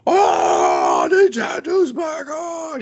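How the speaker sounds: put-on high, slow overjoyed kid yell; excited shout. Kids excited about Nintendo prize.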